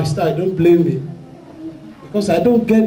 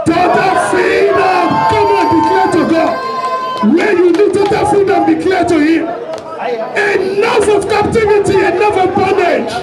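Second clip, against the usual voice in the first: high, forceful declaring prayer; 7 semitones higher than usual. Real, not acted. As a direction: call out freedom with full force. Leads congregation in loud prayer.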